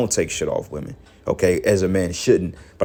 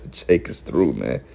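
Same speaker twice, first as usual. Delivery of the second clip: real chuckling, soft and low; chuckles either side of the words; amused dismissal; 5 semitones lower, 4 dB quieter, slower than usual. Real, not acted. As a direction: laugh quietly as you write them off. Laughing at the people he criticises.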